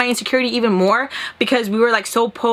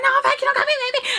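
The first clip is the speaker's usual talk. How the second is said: mock screaming; much faster than usual. Screaming at the ex on a call, high mock scream. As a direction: imitate screaming down the phone. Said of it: "screaming at him".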